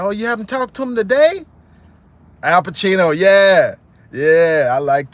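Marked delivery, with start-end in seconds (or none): 0.0-1.4 high, louder probing question; mock suspicion. A thug probing the cousin, high probing voice. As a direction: press him with a rising question. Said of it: "He said".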